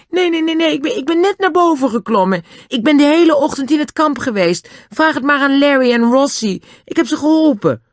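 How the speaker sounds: put-on high, frightened boy stumbling over his words; panicked denial. Caught boy frantically denies spying to Dan. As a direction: babble your innocence, scared.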